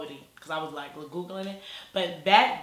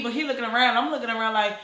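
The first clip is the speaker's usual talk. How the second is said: ordinary talk, nothing marked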